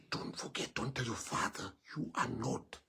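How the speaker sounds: put-on mother whispering a secret to her child. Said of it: "she's like".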